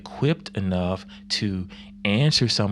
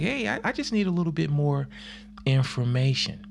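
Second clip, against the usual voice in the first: high, fast plea for more; pleading Thomas; 6 semitones higher than usual. Retold or imitated. Thomas asking for more facts, high and quick. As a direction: ask for just a bit more, higher. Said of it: "Thomas like".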